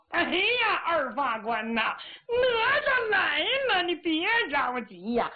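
high, slow whiny voice unlike the storyteller's; strange squeaky voice. Acted. Fake Nezha answering from the woods, odd high whiny voice. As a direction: sing out in a weird warbling cry. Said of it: "这什么动静儿".

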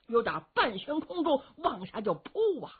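plain reading voice

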